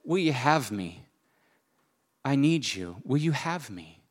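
softly asking, a humble surrender; a soft heartfelt plea to God; tender plea. Retold or imitated. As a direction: pray it gently and quietly. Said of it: "surrendered to and said".

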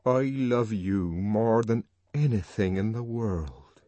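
ordinary delivery, nothing marked

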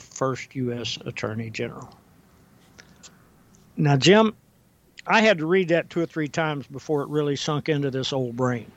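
normal talking voice

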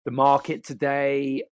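slow, drawn-out run-on sentence, lightly done; dragging out a never-ending question, mock LA style; US-style valley talk on British words. Shown as a demonstration. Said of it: "I'll do a U K version of it".

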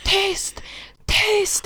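high shrieked hush; screaming at the nagging friend to be quiet. Retold or imitated. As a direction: scream for silence. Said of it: "du skriker verkligen".

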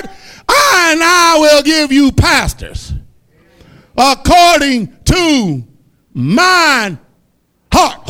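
slow, loud, high shouted preaching; preaching at full force. Acted. Preacher shouting scripture at the brothers, slow and loud. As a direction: thunder the scripture to the congregation.

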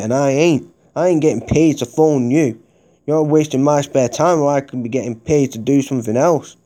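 ordinary talk, nothing marked

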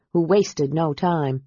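ordinary delivery, nothing marked

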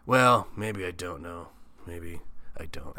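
slow, drawn-out hedging; evasive stalling. Retold or imitated. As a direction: stall like a man dodging blame. Camp owner's evasive quote.

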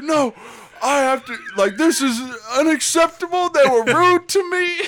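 high-pitched indignant mock rant; mad old granddad. Granddad refusing to let rudeness go, mock outrage. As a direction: act out an outraged old man, voice climbing. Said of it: "why are you so mad".

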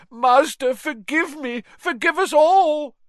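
very high, loud begging; frantic, screamed plea; terrified shriek. Acted. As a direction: shriek for mercy at your master's feet. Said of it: "he shrieked".

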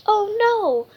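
startled oh no; alarmed oh no. Acted. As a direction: cry out in dismay. Dog jumps at judge.

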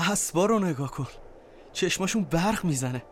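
ordinary talk

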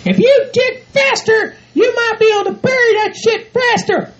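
high falsetto, deliberate nagging; bossy drill-sergeant partner. Acted. Bride hurrying groom at the hole, bossy. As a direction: push him on in a high put-on voice.

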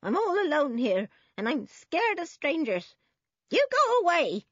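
put-on high, agitated old woman's voice; frightened old woman. A narrator's reading. Old woman scared of strange policemen, high and agitated. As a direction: plead high, then shout them away.

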